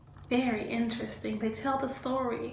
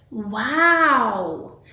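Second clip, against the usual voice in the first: a louder, surprised "Wow"; 8 dB louder than usual. Acted. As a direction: react like a dad amazed by the fact. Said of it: "Dad said with surprise".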